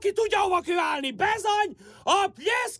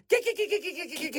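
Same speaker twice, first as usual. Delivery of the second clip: rapid little squirrel-luring call, fading softer; calling animals; 3 semitones lower, 4 dB quieter, much faster than usual. Acted. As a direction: coax squirrels with a chattered call.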